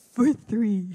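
"three" said with a clear th. Retold or imitated. Nana finally hearing "three" not "free". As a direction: land on "three" with a sharp th. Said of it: "I'm like".

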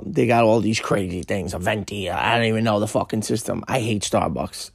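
ordinary delivery

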